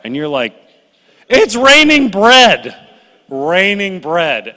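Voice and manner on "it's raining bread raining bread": high, loud and slow, stretched in disbelief, then easing down; can't believe they're complaining about bread from the sky; incredulous exasperation. Retold or imitated. Preacher mocking the Israelites who reject manna, incredulous.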